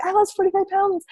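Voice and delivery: proud client. Retold or imitated. Client in her dress telling the coach, quietly proud. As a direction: lightly voice the client showing off her loss. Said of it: "she was like".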